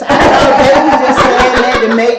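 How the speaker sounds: loud, laughing answer. Real, not acted. Mother laughing while answering her friend's question. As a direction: laugh through the explanation.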